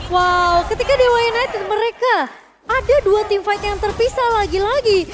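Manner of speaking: loud, excited analysis; excited caster. Acted. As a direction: ride the hype of the fight. Co-caster joins the hype.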